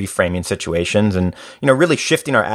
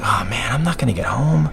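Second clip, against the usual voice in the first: dejected whisper. Retold or imitated. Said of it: "I'm just like".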